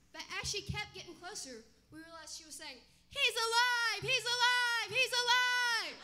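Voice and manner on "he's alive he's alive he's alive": loud, high, the last one drawn out slowly; joyful yell. Retold or imitated. [name] mimics Mary yelling the news. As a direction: shout the news as you arrive. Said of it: "she's yelling".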